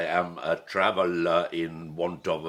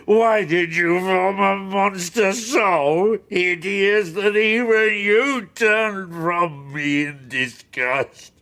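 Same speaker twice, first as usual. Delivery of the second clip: much higher and louder, a slow raging shout; furious outcry; 11 semitones higher, 9 dB louder than usual. Acted. The creature curses his creator in a raging shout. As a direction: hurl it at your maker.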